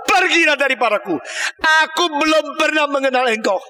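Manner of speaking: high, stern dismissal; Jesus banishing. Acted. Jesus disowns the evildoers, high and stern. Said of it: "Yesus Kristus berkata".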